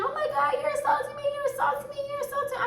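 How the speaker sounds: very high, squealed imitation of yelling; high-pitched mocking squeal without real volume. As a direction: mimic her panicked yell in a high falsetto. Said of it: "She yelling".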